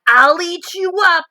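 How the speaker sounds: put-on cheeky wild-boy threat; Max's threat. A narrator's reading. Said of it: "and Max said".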